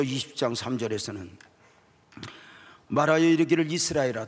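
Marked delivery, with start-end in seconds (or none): 2.9-4.3 much louder, higher call; proclaiming. Acted. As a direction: call out to Israel. Proclaiming scripture.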